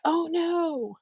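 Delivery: high-pitched mock "oh no"; retold dismay. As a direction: wail it high, replaying your own dismay. Dismayed reaction on landing in wrong show.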